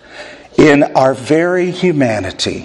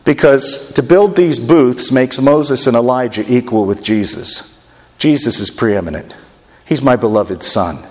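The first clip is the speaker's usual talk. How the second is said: gentle correction; 5 semitones lower than usual. Retold or imitated. God gently telling Peter his idea is bad, gentle correction. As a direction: lower voice, gently letting someone down. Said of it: "God's way of very gently saying".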